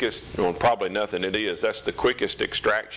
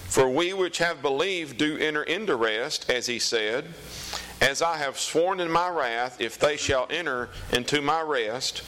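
scripture reading; 5 semitones higher than usual. Acted. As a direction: read it out like the pulpit. Reads scripture aloud.

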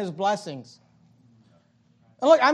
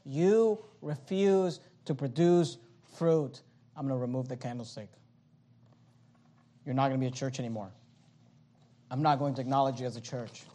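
hushed divine verdict; 10 semitones lower, 10 dB quieter than usual. Acted. God to New Testament churches, hushed verdict. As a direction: drop right down for a quiet, grave sentence.

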